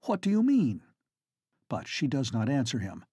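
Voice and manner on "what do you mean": high, alarmed question; startled demand. Acted. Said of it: "gasps the young Duke".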